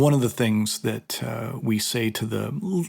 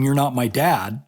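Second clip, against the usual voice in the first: ordinary delivery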